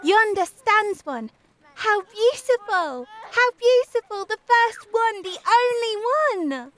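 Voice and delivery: high-pitched, gleeful exclamations; rejoicing children. A narrator's reading. Children find the first flower of the year, rejoicing. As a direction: marvel aloud with mounting excitement. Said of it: "cried some children rejoicingly".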